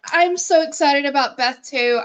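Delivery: ordinary talk, nothing marked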